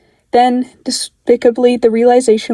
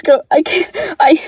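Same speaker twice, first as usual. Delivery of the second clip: higher, words faltering and cutting off; breaking down; 6 semitones higher than usual. Acted. Dick losing his words as Tim dies, higher faltering grief. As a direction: let the sentence fall apart in grief.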